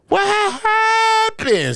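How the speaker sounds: high, very slow incredulous wail; mock disbelief. Real, not acted. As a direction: stretch it out in shock. Incredulous drawn-out question.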